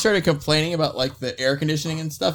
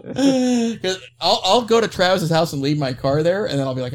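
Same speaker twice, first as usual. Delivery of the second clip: ordinary delivery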